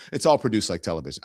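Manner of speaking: usual speaking voice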